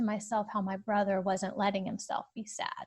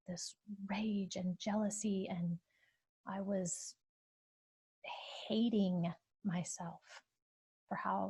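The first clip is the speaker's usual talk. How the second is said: whispered confession; 7 dB quieter than usual. Real, not acted. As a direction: breathe out the words as if ashamed. Confessing her real feelings.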